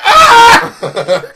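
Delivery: loud high laughing scream; mock scream. Waking up in the furnace, laughing mock scream. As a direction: shriek like waking in flames.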